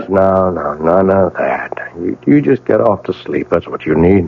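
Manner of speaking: gentle husband settling his frightened wife; soothing. Acted.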